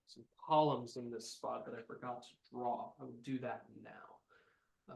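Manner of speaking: 1 muttered aside. Real, not acted. Quiet aside while drawing.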